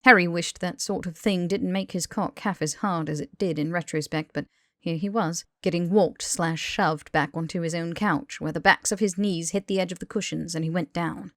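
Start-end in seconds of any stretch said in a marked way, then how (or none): none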